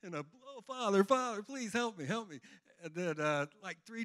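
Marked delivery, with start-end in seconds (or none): 0.5-2.4 desperate plea. Retold or imitated. Desperate retold prayer.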